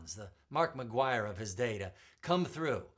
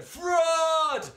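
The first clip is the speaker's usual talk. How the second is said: echoed crowd cry; 12 dB louder than usual. Acted. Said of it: "An echo answered".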